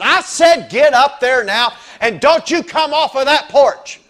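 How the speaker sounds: loud, slow, high furious command; commanding shout. Retold or imitated. Woman in London shouting at the little dog. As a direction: bellow it like ordering the dog off for good. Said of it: "she turned around and said".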